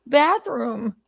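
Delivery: high, grateful little dog voice. Pretend. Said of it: "Dog's like".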